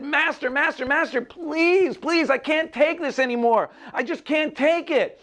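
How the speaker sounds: very high, desperate plea; begging and pleading. A narrator's reading. Lump of clay to the master pounding it, desperate begging. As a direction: beg frantically at the top of your range. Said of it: "And I begged and I pleaded".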